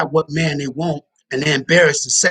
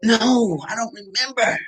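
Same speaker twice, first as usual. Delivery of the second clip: mock timid girl; 6 semitones higher, slower than usual. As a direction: answer small and high. Girl voiced answering.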